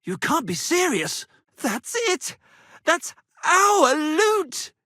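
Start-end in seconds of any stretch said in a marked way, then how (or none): 1.6-4.7 high, loud and slow on the loot; excited discovery. Acted. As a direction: shout it out as if you've found treasure. Minstrels spot their stolen goods.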